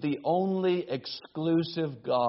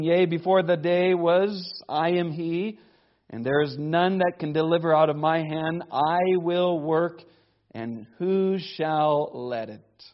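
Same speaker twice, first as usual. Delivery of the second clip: louder, solemn reading of scripture; 2 semitones higher, 4 dB louder than usual. Acted. Preacher reads scripture aloud.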